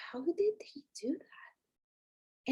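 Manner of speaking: slow breathless whisper of disbelief; hushed, slow, amazed whisper about her late father; awed whisper. Retold or imitated. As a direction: breathe it out, barely voiced, in wonder. Wondering aloud about her dad.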